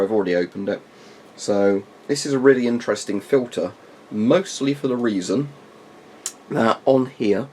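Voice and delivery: normal talking voice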